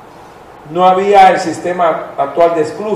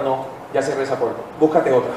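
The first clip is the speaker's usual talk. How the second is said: low, much softer, curt reply; God's answer; 4 semitones lower, 8 dB quieter than usual. Retold or imitated. God refusing the one who prays, low and soft. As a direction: brush them off calmly and low.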